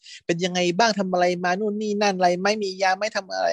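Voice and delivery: high, fussy mock concern; fussing worried friend. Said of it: "เป็นห่วงเป็นใยใหญ่เลยว่าแบบ".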